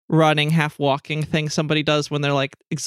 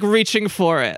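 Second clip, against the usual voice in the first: usual speaking voice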